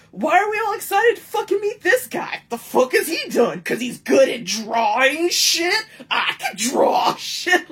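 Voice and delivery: high, loud, indignant ranting; belligerent inner rant. Retold or imitated. Fan in line ranting in her head, loud and indignant. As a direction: fume at the hype in a mock yell. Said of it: "getting real belligerent with it in my head".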